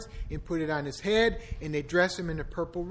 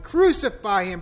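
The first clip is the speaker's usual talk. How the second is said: loud, high shout; 4 semitones higher, 9 dB louder than usual. A narrator's reading. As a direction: hurl the demand out. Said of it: "they shouted".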